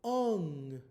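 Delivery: "Ung" held as one long syllable ending in ng; glued sound. Shown as a demonstration. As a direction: draw out "Ung" with vowel and ng joined. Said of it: "for this glued sound".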